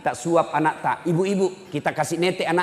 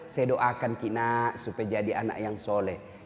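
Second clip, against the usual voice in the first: put-on softer, gentle answer to a child; tender mother; 6 semitones lower, 7 dB quieter than usual. Mother gently telling her child why she prays. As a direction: soothe the child with the words.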